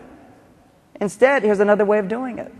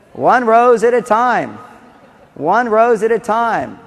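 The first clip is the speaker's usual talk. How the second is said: slow, louder stress on rose; emphatic slogan; 4 semitones higher, 4 dB louder, slower than usual. Shown as a demonstration. Speaker to men in the audience, slow emphatic slogan. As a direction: drive home "rose" slowly. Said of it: "repeated slogan".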